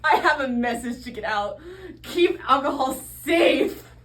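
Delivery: loud, slow, fiery reformer; shouting reformer. Acted. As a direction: proclaim it loud and slow like a zealot. Zealous reformer shouting message.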